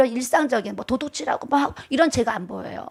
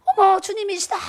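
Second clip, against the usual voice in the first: awed exclaiming; 8 semitones higher than usual. Retold or imitated. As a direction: shout it out as if seeing the Lord. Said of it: "그랬어요". (감격해 외치는 목소리)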